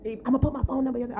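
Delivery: high, fast pleading man's voice, hammed up; teasing imitation of a pushy guy; mock eager man. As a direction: squeak out his begging in a silly high voice. Man begging on her live.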